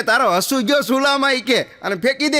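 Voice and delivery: the customer snaps at the shopkeeper and tells him to throw it away; fed-up yell; exasperated customer. Acted. Customer loses patience, yells.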